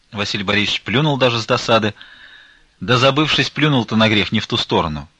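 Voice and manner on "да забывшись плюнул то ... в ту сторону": ordinary delivery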